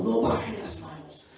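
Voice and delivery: loud, slow commanding voice of God; preaching at full force. Acted. Preacher voicing God to the congregation, loud and slow.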